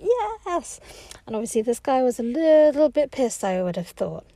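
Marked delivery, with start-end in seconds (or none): 0.0-0.8 a much higher whoop; excited cheer. Retold or imitated. She cheers the song with the lads, excited whoop. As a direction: throw it up like a party cheer.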